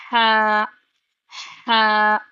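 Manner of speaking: a strong throaty ہا. Shown as a demonstration. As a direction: push ہا from the middle of the throat. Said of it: "حلق کے درمیانی حصے سے آواز آئے گی".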